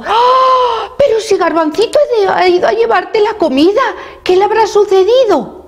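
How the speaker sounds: very high gasping dismay; alarmed mother. A narrator's reading. Mamá learns Garbancito never arrived, high alarmed voice. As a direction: exclaim in high-pitched worry.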